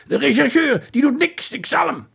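high, loud indignation; angry father. Acted. Father fuming about the detective, high and loud. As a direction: rage at the useless detective.

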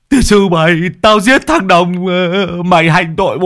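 high loud enraged yelling; furious shouting. Acted. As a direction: curse him out in rage. Man grabbing throat furiously.

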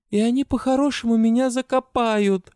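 high, loud hoarse wheeze; wheezy rasp. A narrator's reading. Heroine despairs to the goblin in a high hoarse wheeze. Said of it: "просипела я".

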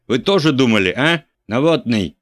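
loud, high furious shout; angry shout. A narrator's reading. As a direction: bellow the accusation. Bishop yells at typesetter. (епископ кричит на наборщика)

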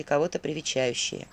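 usual speaking voice

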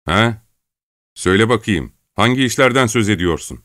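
hard, challenging demand; harsh questioning. A narrator's reading. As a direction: press the frightened lad for an answer. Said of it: "sert bir sesle".

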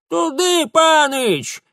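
very high, loud cry; old woman's yell. A narrator's reading. Old woman tucked under his arm points the way, yelling. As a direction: yell directions in a granny voice. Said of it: "завопила из подмышки бабка".

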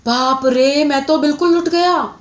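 high-pitched acted lament; despairing wail. A narrator's reading. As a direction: cry out as a ruined man. Ruined merchant laments, beats head.

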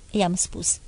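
plain reading voice

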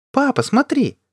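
put-on very high, loud little-girl shout; daughter's sudden piercing call for attention; child's cry. A narrator's reading. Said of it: "оглянулся на крик дочери".

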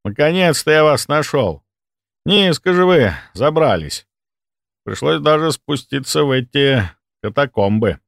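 count's emphatic, high-spirited greeting; hearty visiting count. Acted. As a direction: greet him grandly, with emphatic complaint. Count greets narrator in morgue.

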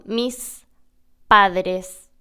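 "Mis" with a full, hissed s before "padres"; full s contrast. Shown as a demonstration. Showing learners what he doesn't say, a full s. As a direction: separate "Mis" and "padres", hiss the s. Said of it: "No digo".

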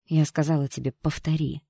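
usual speaking voice

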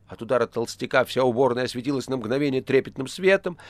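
plain reading voice

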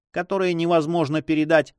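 ordinary delivery

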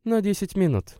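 a hint of breathlessness; catching her breath. A narrator's reading. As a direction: say it as if she just ran up the stairs. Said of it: "переводя дыхание".

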